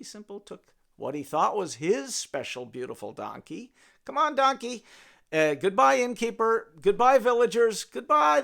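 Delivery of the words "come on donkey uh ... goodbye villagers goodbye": bright, loud happy farewells; cheerful goodbyes. Acted. Jackie bidding the innkeeper and villagers goodbye, loud and cheerful. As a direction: wave everyone off cheerfully.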